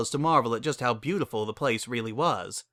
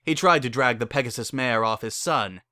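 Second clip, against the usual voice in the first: usual speaking voice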